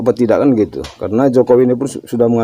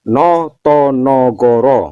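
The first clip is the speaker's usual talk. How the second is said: slow syllables; much slower than usual. Shown as a demonstration. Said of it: "No itu Soekarno".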